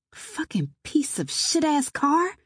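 slow, high, hissed curse; drawn-out exasperated swearing under strain; frustrated hiss. Acted. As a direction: hiss at the broken-down car. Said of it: "I hissed".

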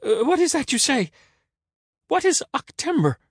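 very high, loud questioning; baffled Caesar. A narrator's reading. Caesar puzzled by the beggar's omen, high demand.

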